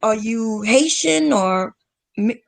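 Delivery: usual speaking voice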